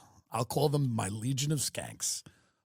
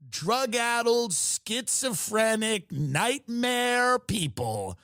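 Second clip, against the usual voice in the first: slow dramatic emphasis; 7 semitones higher, much slower than usual. Real, not acted. Dramatic slow list of insults.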